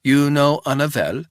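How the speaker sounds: put-on wary old housekeeper in a thick Swiss accent; old housekeeper questioning a stranger, thick Swiss accent. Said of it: "in a thick Swiss accent".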